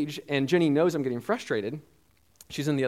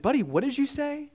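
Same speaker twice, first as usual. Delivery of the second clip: incredulous; 13 semitones higher than usual. Retold or imitated. Dad checking what toddler said.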